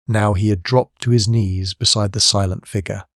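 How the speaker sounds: ordinary delivery, nothing marked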